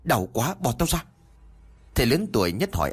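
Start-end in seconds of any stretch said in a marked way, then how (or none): 0.0-1.0 pained cry. A narrator's reading. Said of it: "làm cho anh gào lên".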